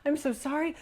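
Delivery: high, mock-crying apology; imitated tearful apology. Woman retells crying as she apologises to him, high pleading apology. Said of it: "I'm crying and".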